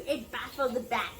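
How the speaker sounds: plain reading voice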